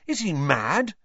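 high, incredulous question; gasping disbelief. A narrator's reading. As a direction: gasp it, utterly aghast. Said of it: "Ron gasped".

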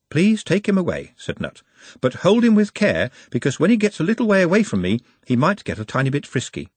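gentle, careful orc voice; Nutt's voice. A narrator's reading. Nutt voiced in audiobook.

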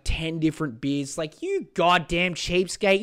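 normal talking voice

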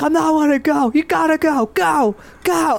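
high, pushy "go, go" as Cage; urgent quote. Retold or imitated. Friend acting out Cage urging at the wall, high insistent voice. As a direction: urge him on, high and insistent.

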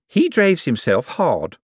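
slow, high, emphatic praise; high-pitched, earnest secretary; Miss Drapes defending. Acted. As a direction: stress each word loyally. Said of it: "said Miss Drapes".

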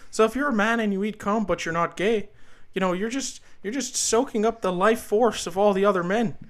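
earnest, aggrieved professor impression; Jordan Peterson. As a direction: rant like an indignant lecturing academic. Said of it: "I got the Peterson".

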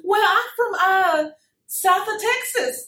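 put-on high, playful Southern accent; Jolene. Jolene telling the host her hometown, Southern accent. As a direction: lilt it up like a Texas belle with a Southern accent. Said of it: "with this Southern accent".

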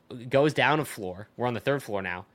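ordinary delivery

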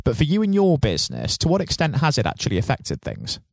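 ordinary delivery

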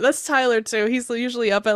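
normal talking voice

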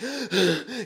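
sharp, high gasp as if jolting awake; mimicked startled intake of breath; imitated waking gasp. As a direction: act out a sudden gasp for air.

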